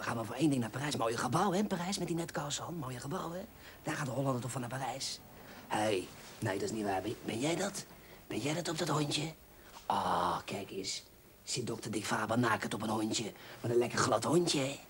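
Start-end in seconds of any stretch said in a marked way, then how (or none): none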